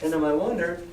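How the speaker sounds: usual speaking voice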